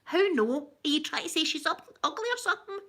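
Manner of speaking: high, offended Ned comeback; wee man. Acted. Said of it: "Yeah, the wee man".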